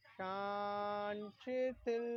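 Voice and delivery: very slow शांत, then शिथिल stepped up in pitch; drawn-out relaxation cue. Real, not acted. Yoga teacher relaxing the Brahma region, drawn-out cue. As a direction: stretch शांत long and level, lift शिथिल.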